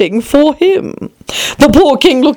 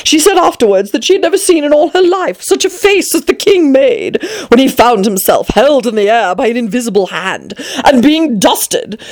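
ordinary delivery, nothing marked

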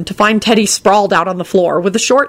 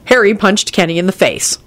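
plain reading voice

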